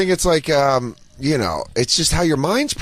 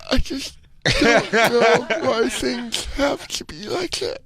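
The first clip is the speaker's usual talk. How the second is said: slow, higher imitation of a sad kid; mock sad kid voice; 6 semitones higher, much slower than usual. Mocking the kid from video.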